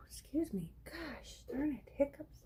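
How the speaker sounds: frustrated whispered gosh. Real, not acted. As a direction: breathe it out unvoiced. Frustrated at a mistake.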